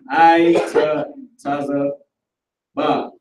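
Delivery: "I, ta, taza, ba" split into separate syllables; sounded out. Shown as a demonstration. Teacher working out a root word, "I, ta, taza, ba" piece by piece. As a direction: break "I, ta, taza, ba" up with pauses between parts. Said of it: "depending on the pronunciation".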